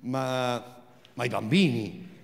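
ordinary talk, nothing marked

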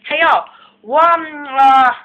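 loud mock Chinese accent. Shown as a demonstration. Taking an order for number five, mock Chinese accent. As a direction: call out in a put-on Chinese accent.

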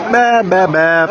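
playful bleating; goat bleat. Pretend. Imitating goats bleating.

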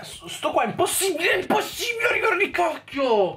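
very high shouted rage; frustrated outburst. Real, not acted. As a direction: yell at the impossible jump. Raging at impossible level. (sfogo urlato, molto acuto)

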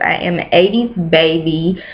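ordinary delivery

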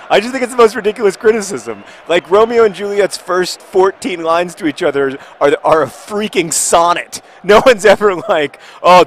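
high, loud exasperated rant; sarcastic outrage at a silly criticism; angry rant. Real, not acted. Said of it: "I'm angry enough about it".

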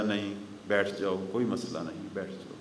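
calm reassurance. Retold or imitated. Imam calms a frightened man.

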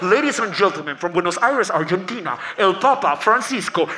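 slow boxing-ring introduction; arena announcer. Pretend. Announcer presenting the Pope from Buenos Aires, slow and grand. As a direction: roll it out like a fight announcer.

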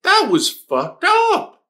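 high stretched-out disbelief; real shocked outcry. Real, not acted. Shocked at the scene.

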